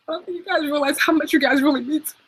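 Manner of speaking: tearful, voice breaking and high; fighting back tears; choked up. Real, not acted. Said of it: "Okay, I'm not gonna cry".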